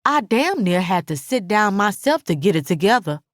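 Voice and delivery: usual speaking voice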